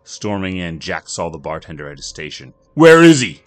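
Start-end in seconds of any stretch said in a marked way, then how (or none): 2.7-3.4 high, very loud angry demand; snarl. Acted. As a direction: storm in demanding the man. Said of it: "Jack snarled".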